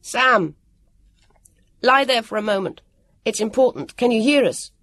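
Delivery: urgent call across a space. A narrator's reading. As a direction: call to someone at a distance. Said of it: "Then he called out".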